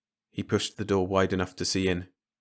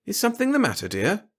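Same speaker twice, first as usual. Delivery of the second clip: higher, innocent wife's voice; Marge's innocent voice; 12 semitones higher than usual. Acted. A wife to her exasperated husband, all innocence. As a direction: feign innocence, sweetly.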